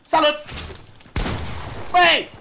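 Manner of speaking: short, high, loud shouts; calling out. Real, not acted. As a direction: call out loudly to get their attention. Calls out loudly to players. (strigat scurt, tare și înalt)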